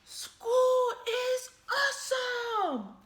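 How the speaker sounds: high, loud, slow excited shout. Acted. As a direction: belt it out like an overjoyed shark. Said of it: "shouted Clark the Shark".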